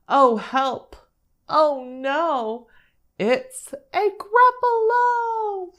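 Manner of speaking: high, loud storybook cry for help; hammed-up panic, rising to a long high wail; mock alarm. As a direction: cry out in drawn-out play fright. Mouse meets the monster in story.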